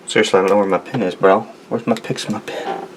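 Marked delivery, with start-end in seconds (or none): none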